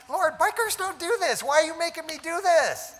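high, pleading cry to God, voice breaking; tearful plea, pitched well up; retold crying prayer. Biker telling church he cried to the Lord, high tearful plea. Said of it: "I'm sitting there crying".

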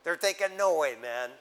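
ordinary talk

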